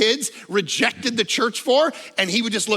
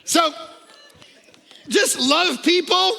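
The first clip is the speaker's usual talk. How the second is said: preaching at full force; 8 semitones higher, 4 dB louder than usual. Acted. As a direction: drive the call home to the congregation. Sermon climax over music.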